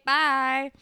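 high, loud sign-off; sassy dismissal. Retold or imitated. Girl brushing off her ex after a comeback, sassy.

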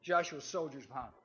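ordinary delivery

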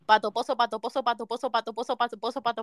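low, soft, rattled tongue twister; repetición rápida. Real, not acted. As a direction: repeat it quickly in one run. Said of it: "hácelo rápido". (trabalenguas rápido, grave y bajito)

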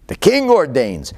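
a mildly hammed-up pompous king; grand proclamation. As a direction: announce it like a royal decree. King proclaims his own decree.

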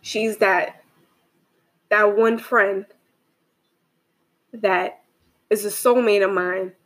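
halting, with long pauses between phrases; a little emotional. Real, not acted. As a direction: hold back emotion, pausing often. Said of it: "I might get a little emotional".

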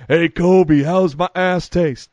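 Shaq impression. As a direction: drag the taunt out like Shaq. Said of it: "he kept repeating".

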